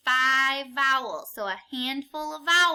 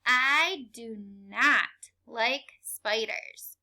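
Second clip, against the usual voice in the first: slow, word by word; slow choral reading; 3 semitones lower, slower than usual. Shown as a demonstration. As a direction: lead the class slowly. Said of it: "Read it with me".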